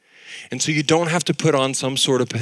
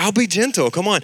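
coaxing dad; 7 semitones higher, faster than usual. Retold or imitated.

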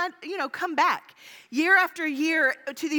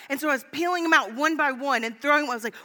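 ordinary delivery

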